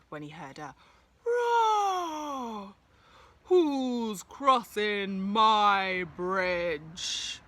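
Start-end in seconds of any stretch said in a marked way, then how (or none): 1.2-2.8 high, loud roaring cry; troll roar. Acted. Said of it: "growled the troll". 3.5-7.4 slow, drawn-out, loud troll voice; growling troll. Acted. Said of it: "growled the troll".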